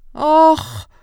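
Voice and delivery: loud, high exclamation of shock; shocked cry. A narrator's reading. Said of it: "riep poppendokter Feingold geschrokken".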